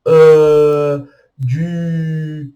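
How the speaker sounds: "E" and "du" held long on rounded lips; prolonged pouted vowels. Shown as a demonstration. Teacher imitating French speakers' hesitation vowels, prolonged sounds. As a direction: stretch out E, du with a pout. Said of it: "assim, mais prolongado".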